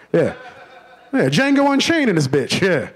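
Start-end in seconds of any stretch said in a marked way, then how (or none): none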